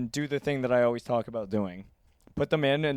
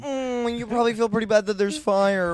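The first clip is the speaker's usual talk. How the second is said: higher smug mock voice, lightly played; smug owner; 6 semitones higher, 4 dB louder than usual.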